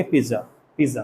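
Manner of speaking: "पिज़्ज़ा" as short "pizza" with no t; quick voiced middle in "पिज़्ज़ा"; common pronunciation. Shown as a demonstration. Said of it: "So हम ऐसे बोलेंगे". ("पिज़्ज़ा" को छोटा "पिज़ा" बोलें)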